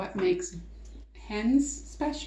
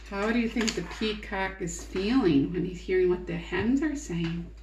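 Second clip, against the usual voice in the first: usual speaking voice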